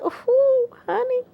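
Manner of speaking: high-pitched appreciative gush; swooning admiration. Real, not acted. Vlogger gushes over the actor's accent, swooning admiration. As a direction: coo it high, savoring the thought.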